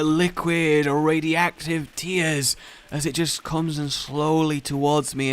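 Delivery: usual speaking voice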